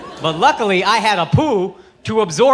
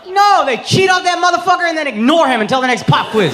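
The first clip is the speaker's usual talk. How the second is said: shouted rant; 6 semitones higher, 3 dB louder than usual. Real, not acted.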